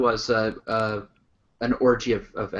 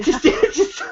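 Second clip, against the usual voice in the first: higher voice breaking into laughter; laughing; 8 semitones higher, slower than usual. Real, not acted. Co-host amused by a botched dictator's name, laughing. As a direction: crack up partway through and fail to finish. Cracking up over a mispronounced name.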